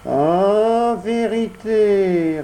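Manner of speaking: high odd echoing cat voice. Acted. Cat echoes the fox in a high whine. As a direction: parrot the fox's last word. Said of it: "répéta le chat".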